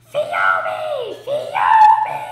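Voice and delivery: full-force high-pitched scream, drawn out. A narrator's reading. Enzo screaming for Phoebe through a crowd, foghorn scream. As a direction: bellow the name like a small child with huge lungs. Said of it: "Enzo took a huge breath and screamed".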